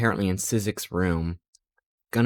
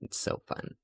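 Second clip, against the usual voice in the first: whispered aside; 11 dB quieter than usual. Real, not acted. Aside after flustered story.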